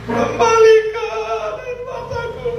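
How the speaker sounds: high sung wailing plea, slow; crying out. Acted. Widow wailing to God over the body, sung high cry. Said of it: "Dia menangis menjerit".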